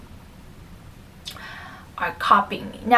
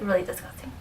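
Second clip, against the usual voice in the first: ordinary talk, nothing marked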